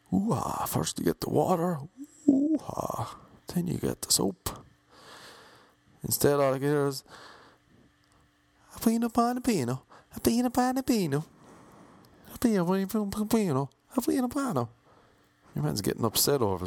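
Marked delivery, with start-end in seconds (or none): 0.1-4.5 awed Pacino impression. Imitating Pacino's fascinating talk. 8.8-14.7 mock Italian gibberish.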